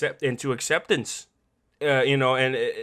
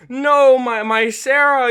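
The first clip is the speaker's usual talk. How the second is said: loud, high imitated wail; anguished cry; 10 semitones higher, 11 dB louder, slower than usual. Father over his dying daughter, loud anguished imitation. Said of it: "he's screaming, he's crying".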